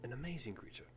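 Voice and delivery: gentle, fond talk to a small creature; soft doting voice. Acted. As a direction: coo at the little guy like a pet lover. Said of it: "and he goes".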